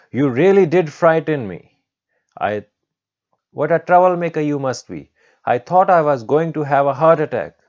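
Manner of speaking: high, loud, flustered complaint; rattled scolding teacher. Acted. Teacher tells off the troublemaker who frightened her, flustered scolding.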